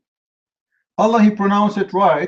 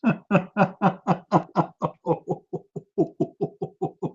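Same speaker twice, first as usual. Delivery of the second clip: long breathy chuckle under the breath; soft mocking laugh; 6 dB quieter than usual. Real, not acted. Debater laughing at an opponent who doesn't know the verse, soft chuckle. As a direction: quietly laugh at him.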